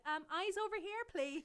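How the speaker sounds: lifted, polite teacher call; teacher calling attention. Retold or imitated. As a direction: call distracted pupils back to you, politely. Teacher voiced calling distracted kids.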